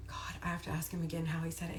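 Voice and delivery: hushed, near-whispered aside; muttering to self. Real, not acted. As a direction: think aloud under your breath. Asks herself, trying to recall.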